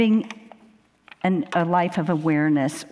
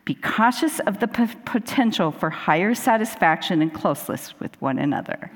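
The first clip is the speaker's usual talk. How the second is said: mock commercial voiceover. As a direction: deliver a mock-grave disclaimer, drawing out the caution. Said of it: "a mock voiceover from, uh, from, like, a commercial".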